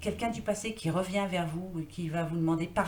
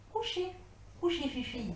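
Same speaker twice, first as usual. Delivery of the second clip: ordre chantant, bien plus aigu; ordre à l'animal; 8 semitones higher, 6 dB quieter than usual. Real, not acted. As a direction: comme on parle à un petit chien. Ordonne à l'animal de se coucher. (ordonne à Fifi de se coucher, voix très aiguë)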